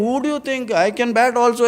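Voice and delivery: high-pitched mock indignation; comic offended batsman; indignant retort.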